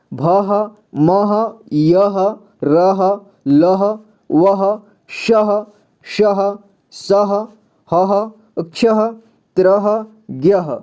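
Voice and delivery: भह to ज्ञह one at a time, each ending in a clear "ha"; measured demo of भह as "bha-ha", and so on to ज्ञह; syllable drill. Shown as a demonstration. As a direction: read भह, मह, यह as spaced drill items. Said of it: "इतना ऐसे आपको पढ़ना है".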